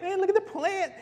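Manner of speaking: high, loud, giddy elation. Acted. Preacher playing Jonah delighted by the plant, giddy. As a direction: crow with delight like a thrilled kid. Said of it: "Jonah is just giddy. He's like".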